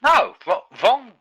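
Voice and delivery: sharp high exclamation; loud objection. Real, not acted. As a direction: cut in loudly, correcting. Objecting to wrong era joke.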